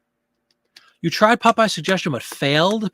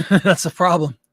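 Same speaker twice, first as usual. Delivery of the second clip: laughing; 4 dB louder than usual. Real, not acted. Laughing at users leaving platform.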